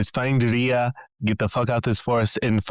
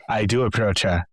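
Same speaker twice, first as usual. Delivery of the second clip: usual speaking voice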